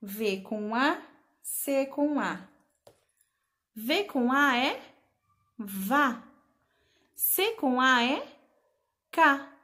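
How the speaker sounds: slow, deliberate V com A, C com A; slow syllable blending. Shown as a demonstration. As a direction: build each syllable slowly. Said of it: "Vamos separar as sílabas?". (juntar as sílabas devagar)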